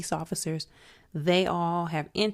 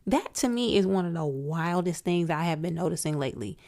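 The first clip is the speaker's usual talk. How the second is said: usual speaking voice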